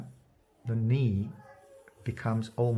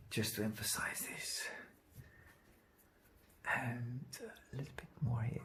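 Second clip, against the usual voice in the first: softer whisper to himself while working; whispered aside; 13 dB quieter than usual. Real, not acted. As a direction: murmur it unvoiced, absorbed in the work. Painter murmuring while working.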